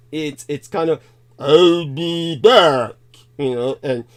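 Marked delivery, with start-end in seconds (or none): 1.4-2.9 put-on slow, heavy Austrian accent, louder; Schwarzenegger accent. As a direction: drag it out like an Austrian action hero. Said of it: "coming across like the original Schwarzenegger".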